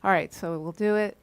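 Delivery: ordinary talk, nothing marked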